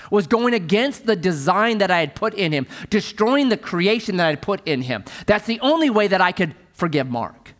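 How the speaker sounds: ordinary talk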